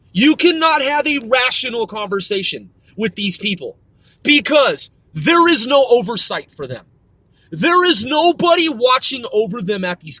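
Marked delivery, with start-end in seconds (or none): none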